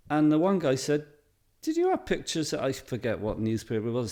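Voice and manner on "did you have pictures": a much higher pitched, eager question; lightly voiced eager student. Voicing a class member asking.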